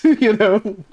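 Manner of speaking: breaking up with laughter; real laugh spilling over. Real, not acted. Laughing at the story.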